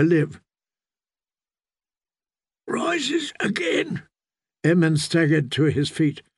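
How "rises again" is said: very high, strained, drawn-out words; pained, choking speech. A narrator's reading. Coughing boy repeats the priest's rite, high, slow and pained. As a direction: struggle each word out slowly through pain. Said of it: "Every word was bought with pain".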